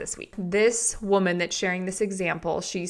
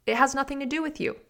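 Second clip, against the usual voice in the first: high, fast, dismissive snap; mock annoyed retort; 7 semitones higher, faster than usual. Wife's imagined retort to her husband, fast and dismissive. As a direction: brush him off quickly and high. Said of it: "her thought about him asking her that is this".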